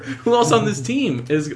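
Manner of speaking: laughing. Real, not acted. Laughing at heat check joke.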